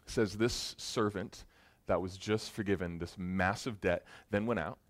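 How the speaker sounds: ordinary talk, nothing marked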